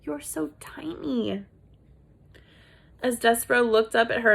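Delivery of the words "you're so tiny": breathy princess whisper. Acted. Princess whispering fondly to Despereaux. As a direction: whisper it, high and slow.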